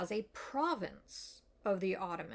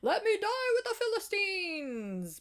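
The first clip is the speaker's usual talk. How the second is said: hammed-up scream of a dying hero; imitated scream; 12 semitones higher, 4 dB louder than usual. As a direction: cry it out high, like Samson's last shout. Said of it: "he screams".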